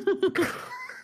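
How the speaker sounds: squeaky burst of laughter; high squealing laugh. Real, not acted. Laughing at ASMR joke.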